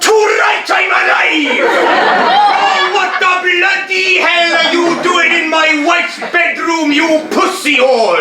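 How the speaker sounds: angry shout. Acted. Husband returns, angry shouting.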